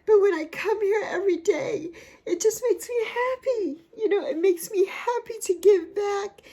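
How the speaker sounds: high, teary voice with a laugh through the tears; choked up, laughing and crying at once; tearful emotion. Real, not acted. Vlogger to viewers about giving back, tearful high voice. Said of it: "I'm still emotional".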